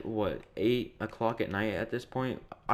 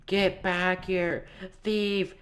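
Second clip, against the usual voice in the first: much higher mock yell of get back here; 9 semitones higher, much slower than usual. Karen yelling at the departing customers, a high mock yell. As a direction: imitate her shrieking after them across the store. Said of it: "she literally is yelling".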